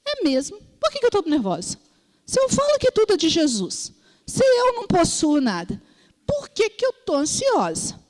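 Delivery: acted-out wondering aloud to oneself; self-questioning. Retells questioning herself before God.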